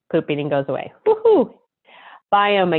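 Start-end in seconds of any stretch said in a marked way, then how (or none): 1.1-1.5 quick high whoop of delight; cheer. Real, not acted. Host cheering for listeners after the fix, a high whoop. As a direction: cheer like the problem just vanished.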